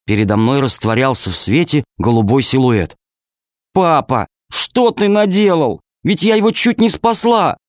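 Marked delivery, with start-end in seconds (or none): 3.7-7.6 put-on upset child. A narrator's reading. Girl upset, ghost gone.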